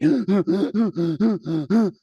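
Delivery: rapid panicked gasping breaths; hyperventilating gasps. Retold or imitated. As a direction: gasp in short frantic breaths. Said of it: "And I'm breathing like".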